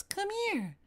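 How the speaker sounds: very high, airy calling voice; distant beckoning voice. Acted. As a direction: call out sweetly from far away. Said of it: "the sweet beckoning voice".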